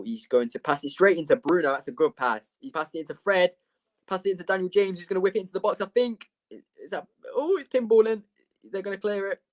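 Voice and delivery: high-pitched running football commentary; live play-by-play. Real, not acted. Streamer calling United's attack for viewers, live play-by-play. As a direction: call the attack as it builds, pitch climbing.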